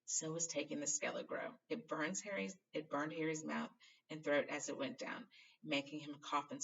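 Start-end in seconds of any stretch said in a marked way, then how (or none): none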